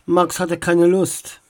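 ordinary delivery, nothing marked

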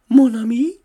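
startled, with a rising questioning end; startled yet serious. A narrator's reading. Dora finding her friend as a rhinoceros, startled and serious. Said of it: "Ihre Stimme klang erschrocken und ernst zugleich".

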